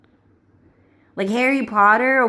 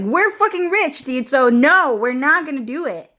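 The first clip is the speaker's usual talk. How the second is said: high-pitched comic defiance; mock America; 7 semitones higher, 3 dB louder than usual. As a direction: refuse in a raised, cartoonish voice. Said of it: "America's like".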